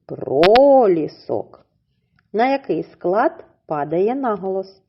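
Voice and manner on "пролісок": "Пролісок" slow and loud with the weight on "лі"; stress on LI. Shown as a demonstration. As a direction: lean on "лі" in "Пролісок", slowly. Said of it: "Поставимо наголос".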